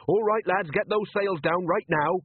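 mock-urgent call to the crew; urgent order. Captain orders crew in emergency.

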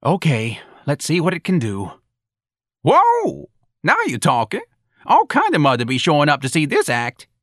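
high, excited whoop and approval; impressed boss. Acted. Boss watching the bird act, increasingly excited. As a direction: go from grudging to thrilled, pitch leaping up.

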